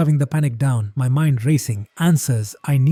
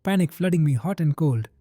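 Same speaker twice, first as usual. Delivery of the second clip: ordinary delivery, nothing marked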